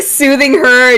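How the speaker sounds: normal talking voice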